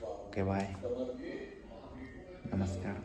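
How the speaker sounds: talking softly, father asleep; quiet at night. Real, not acted. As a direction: keep it soft so Dad sleeps. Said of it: "हळू बोलतो कारण पप्पा झोपले".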